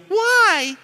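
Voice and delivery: put-on high child's plaintive why; whiny kid why. A five-year-old pestering his dad at Walmart, high childish whine. Little kid voiced in story.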